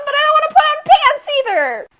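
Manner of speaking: high, loud protest; exasperated whine. Real, not acted. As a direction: whine it out, refusing to budge. Complaining about fetching the package.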